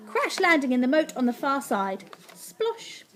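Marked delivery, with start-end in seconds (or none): 2.3-3.0 high, hushed splosh; small high splash sound; splash noise. A narrator's reading. Narrator sounds the slip into the moat, high splosh.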